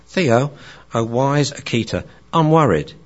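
put-on a fretful young dog pleading with its mentor; worried young dog. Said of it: "he's dealing with his wise friend".

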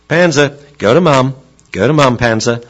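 dad's command. Retold or imitated. Dad sending Panzer to his wife, commanding. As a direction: order the dog off to Mum.

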